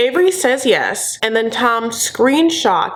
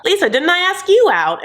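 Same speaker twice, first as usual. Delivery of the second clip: high, demanding put-on accent; cross-examine in a high botched accent; hammed-up mock questioning, pitched high; Tom impression; 10 semitones higher than usual. Same put-on Tom voice questioning Lisa.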